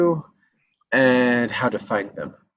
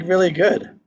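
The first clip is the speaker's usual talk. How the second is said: ordinary talk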